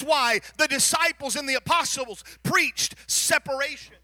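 loud, high-pitched sermon voice; fervent preaching. Acted. Preacher urging his church to change, loud fervent preaching. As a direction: drive every line home to the pews.

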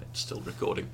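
soft unvoiced whisper; hushed confession. Real, not acted. Confessing the fib in hush.

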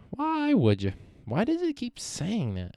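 exasperated squeal. Real, not acted. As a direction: whine it up high at a stubborn website. Exasperated at website results.

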